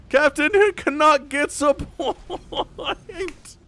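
high, drawn-out giddy call; excited squeal. Real, not acted. Caster celebrating Canuck's point, giddy excited squeal. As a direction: cry it out high and slow, breaking into laughter.

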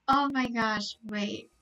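normal talking voice

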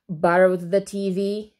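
mild mocking sneer; understated sarcasm. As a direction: finish the sentence scornfully. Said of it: "sneered Mary".

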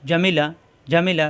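Jamila said quickly with short vowels; brisk correct take; quick short reading. Shown as a demonstration. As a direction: read it fast, short harakat. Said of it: "তাড়াতাড়ি হরকত উচ্চারণ".

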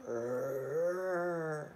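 deep rolled growl; low growl. Acted. Reader growling as the bear.

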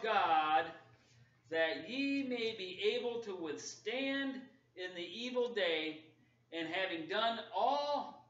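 slow, loud and lifted scripture reading; slow emphatic scripture reading; preaching scripture. Acted. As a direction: proclaim every word like a call to arms. Reads scripture with force.

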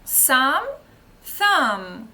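"Сам" then "thumb", loud, s against th; pronunciation drill. Shown as a demonstration. Teacher drills sum against thumb, loud. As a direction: say the pair loudly for the class to copy. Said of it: "великий палець".

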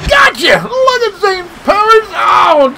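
high excited yell; high triumphant shout. Real, not acted. Excited after a catch.

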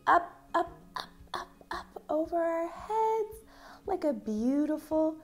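light, high-pitched coaxing upward; rising high voice. Shown as a demonstration. Dance teacher guides arms up over heads, voice lifted high. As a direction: lift the voice high on each up.

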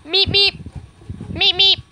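high, loud little meep noises; perky high-pitched meep-meep; playful meeping. Pretend. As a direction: beep out paired squeaks. Playful squeaking beside the dogs.

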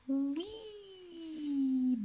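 high, playful wee; gleeful wee. Pretend. Parent playing the bouncing astronaut for the child, high and gleeful. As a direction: squeal with delight.

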